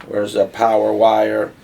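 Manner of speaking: ordinary delivery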